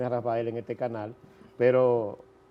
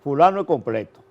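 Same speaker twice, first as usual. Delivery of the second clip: hyping up the guest; 4 semitones higher, 9 dB louder than usual. Real, not acted.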